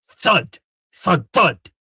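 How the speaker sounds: thud sound. Acted. Imitated bodies falling.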